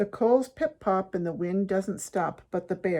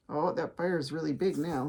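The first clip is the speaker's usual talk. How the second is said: ordinary delivery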